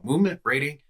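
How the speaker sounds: ordinary talk, nothing marked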